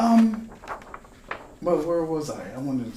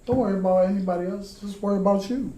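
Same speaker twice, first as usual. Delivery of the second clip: quoting God; 12 semitones higher, 7 dB louder, faster than usual. Retold or imitated. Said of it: "God talked to me".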